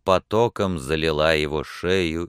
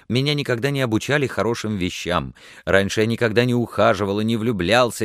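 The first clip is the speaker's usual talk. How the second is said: fast, higher-pitched breathless confession; rushing torrent of words; 6 semitones higher, 3 dB louder than usual. A narrator's reading. Tongue-tied suitor pours out his feelings, rapidly. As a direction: race ahead, stumbling only to find words. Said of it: "понёсся с такой быстротой".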